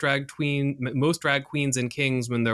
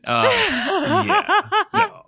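laughing; 5 dB louder, much slower than usual. Real, not acted. Mock agreeing then refusing.

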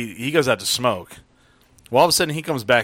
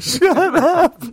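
put-on drunk yell; 7 dB louder than usual. Retold heckle.

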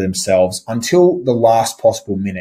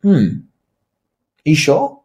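"Hmm, are you sure?" pitched up, disbelieving; doubtful hmm; 4 semitones higher than usual. Shown as a demonstration. Coach voicing what the rising tune tells the buyer, doubtful. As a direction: sound unsure of their answer. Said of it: "creates a sense of disbelief".

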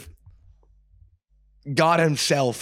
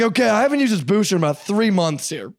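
high, louder voicing of Dalton; lightly playing Dalton, pitched up; teammate voice; 8 semitones higher, 5 dB louder than usual. Quoting teammate Dalton's old booster, pitched up and louder. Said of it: "He's like".

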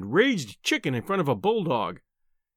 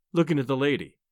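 plain reading voice

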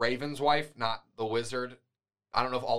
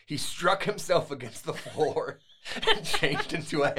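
reading through a laugh; slow, chuckling narration; laughing while reading; 4 semitones higher, slower than usual. Real, not acted. Narrator laughs mid-sentence.

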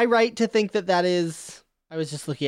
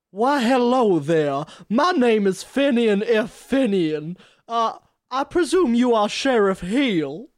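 put-on southern accent. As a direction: introduce yourself like a courtly southern belle. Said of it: "I'm doing this accent".

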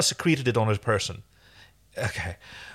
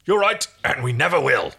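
lightly voiced interrupting character; a touch of character voice, butting in; Rigel; 3 semitones higher, 3 dB louder than usual. A narrator's reading. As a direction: cut in with a put-on voice. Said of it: "Rigel interrupted".

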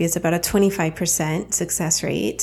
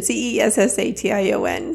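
ordinary delivery

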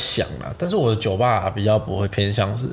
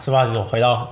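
normal talking voice

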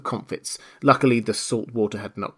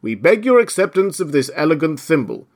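raised, ceremonious speech; solemn presentation; 5 semitones higher, 5 dB louder than usual. A narrator's reading. Dodo presenting Alice the thimble, solemn. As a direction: present it loudly and formally. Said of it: "the dodo solemnly presented her the thimble".

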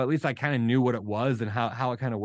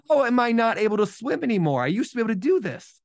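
higher-pitched, incredulous self-questioning; exasperated disbelief; 6 semitones higher than usual. Retold or imitated. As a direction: retell your baffled frustration at yourself. Retold disbelief at being overtaken.

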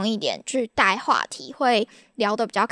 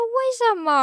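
baffled why; 12 semitones higher than usual. Retold or imitated. She asks why call.

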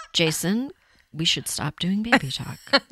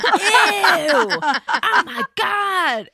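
high shrieking ew of disgust; disgusted squeal; 13 semitones higher, 6 dB louder, much slower than usual. Retold or imitated. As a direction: squeal it with laughter. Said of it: "I was like".

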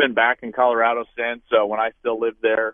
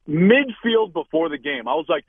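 ordinary delivery

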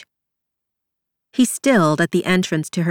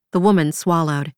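plain reading voice

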